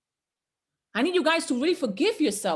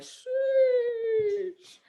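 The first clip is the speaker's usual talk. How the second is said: sheesh; 11 semitones higher than usual. Retold or imitated. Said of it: "And I'm like".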